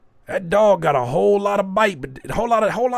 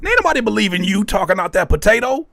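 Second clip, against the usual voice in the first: ordinary delivery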